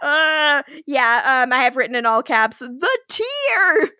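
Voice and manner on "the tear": high, laughing cry; excited. Real, not acted. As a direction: gush over it. Reads her own caps note.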